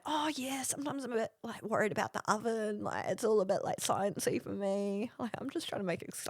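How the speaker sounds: soft, hesitant mock-sheepish; meek excuse-making, starting high; fumbling excuses. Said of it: "fumbling for excuses here".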